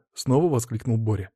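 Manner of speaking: plain reading voice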